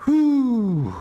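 high, falling whew; long whew. Acted. Preacher reacting to Barak's condition, long high whew.

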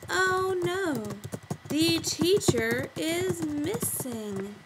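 slow, drawn-out reading while typing; stretched-out dictation to oneself; typing aloud. Shown as a demonstration. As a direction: say each word slowly as you type it. Typing words while saying them.

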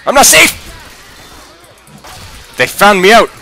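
panicked. Real, not acted. Panicked gamer reacting to attack.

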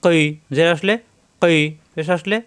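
usual speaking voice

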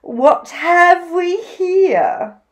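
high, slow, drawn-out question; grand story-time emu voice; fluttery Emu. A narrator's reading. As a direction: coo it with theatrical curiosity. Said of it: "she fluttered".

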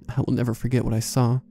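ordinary delivery

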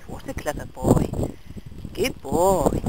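ordinary talk

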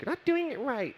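put-on high, bossy little kid voice. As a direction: play the know-it-all nephew. Nephew quoted taking over toy.

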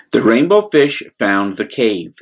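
plain reading voice

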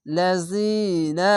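লাযিনা drawn out very slowly, the long ি held; recited with one alif stretch. Shown as a demonstration. Teacher models Quran reading for students, slow drawn recitation. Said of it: "এক আলিফ টেনে পড়বেন".

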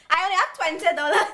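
high, loud, rushed delivery; hammed-up outburst. Acting out the twenty-dollar line, hammed-up loud outburst. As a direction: blurt it out fast and high, rising at the end. Same line acted, escalating.

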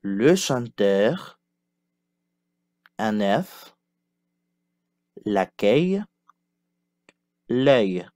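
slowed, careful teacher delivery; slow model repetition. Shown as a demonstration. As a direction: draw each word out for learners. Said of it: "Répétez".

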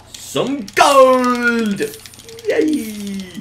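loud, high, long-held whoop; gleeful cheer. Pretend. Player cheering found gold, loud gleeful whoop. Finds treasure in the game.

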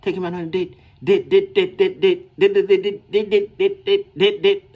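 mock the girls who only want dates; mocking demanding girls. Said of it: "they was like".

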